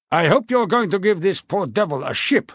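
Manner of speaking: put-on loud, high, bluff old king; a jovial, blustering royal voice. A narrator's reading. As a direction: boom it out like a hearty old monarch. King addresses Chatham.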